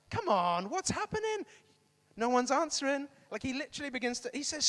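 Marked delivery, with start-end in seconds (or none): 0.1-3.1 loud, high mocking challenge; taunting. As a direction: goad a crowd that is getting no answer. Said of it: "he begins to taunt them".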